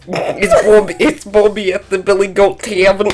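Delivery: very high put-on Chicago accent; mocking Chicago accent. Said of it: "This is my Chicago accent".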